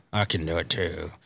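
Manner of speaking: very low, quiet gritty growl; Clint Eastwood growl. Retold or imitated. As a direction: mutter it through gritted teeth. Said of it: "he's like Clint Eastwood".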